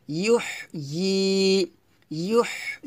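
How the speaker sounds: "ইউহ ই" with airy h, then a stretched "yee"; soft model of "ইউহ ই", holding the last vowel; long "yee". Shown as a demonstration. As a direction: say "ইউহ ই" as "yuhh-yeee". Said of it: "এভাবে".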